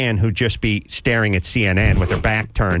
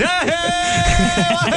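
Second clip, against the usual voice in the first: high wailing chant; wailing to the heavens; much slower than usual. Retold or imitated. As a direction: cry it up to the sky, very high. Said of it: "I would just go to the heavens".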